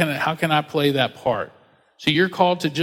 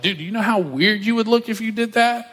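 higher, incredulous skeptic voice; mock skeptic; 7 semitones higher than usual. Doubting listener scoffing at the pastor, higher and incredulous. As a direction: play the doubter scoffing at the idea.

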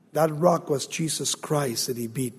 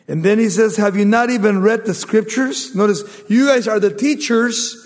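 loud, higher-pitched preacher's delivery; forceful preaching; 7 semitones higher, 9 dB louder than usual. Acted. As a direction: drive it home to the congregation, raised voice.

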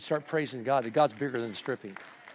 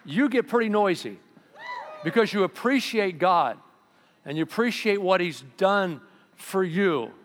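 louder and higher, driving the point home; preaching shout; 8 semitones higher, 7 dB louder than usual. Real, not acted. Pastor rousing the congregation, preaching loudly. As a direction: proclaim it with full force.

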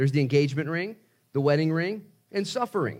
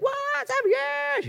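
squeaky, very high incredulous cry; shocked disbelief; much slower than usual. Retold or imitated. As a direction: gasp it out in total disbelief. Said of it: "You're like".

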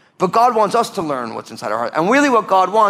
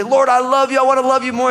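raised high and louder, pleading; fervent prayer; 8 semitones higher, 4 dB louder than usual. Retold or imitated. A believer crying out to the Lord in fervent prayer. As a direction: cry out eagerly to the Lord. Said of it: "you'll say".

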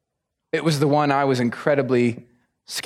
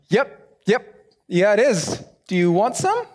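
higher, jittery yes; hurried nervous agreement, pitched up; nervous mock yes; 5 semitones higher, slower than usual. Missionary kid nervously offers the stranger coffee.

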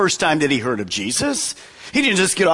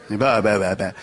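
low mock squawking "bah, bah, bah"; bird squawks; 11 semitones lower than usual. Imitating bird noises.

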